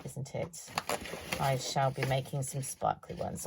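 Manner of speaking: usual speaking voice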